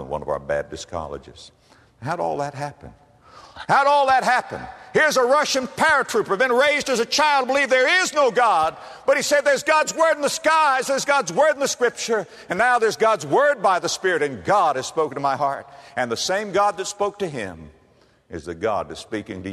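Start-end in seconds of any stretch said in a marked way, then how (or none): 3.7-15.7 high, loud revival cry; shouting preacher. Acted. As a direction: hurl the question out, then keep roaring.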